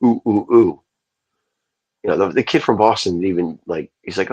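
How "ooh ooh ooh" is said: imitated monkey hooting, high and loud; three raised, punchy mock ape hoots. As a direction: go ooh like a monkey. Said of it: "trying to be like a monkey".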